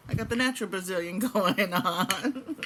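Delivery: loud punchline dissolving into laughter; joking, laughing. Retold or imitated. Reassuring an apologetic friend with a joke, laughing. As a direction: crack up while delivering your own comeback.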